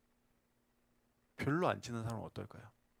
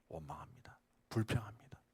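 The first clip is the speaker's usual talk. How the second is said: much lower, hushed whisper; 4 semitones lower, 7 dB quieter than usual. Real, not acted. Pastor confesses complaining in a hushed whisper. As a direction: breathe the words like a confession.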